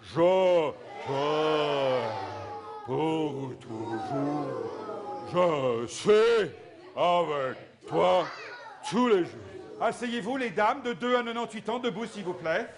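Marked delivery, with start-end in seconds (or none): 0.0-9.4 the men boom it out in a big low voice; big deep voice. Shown as a demonstration. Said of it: "très fort, les, avec notre grosse voix".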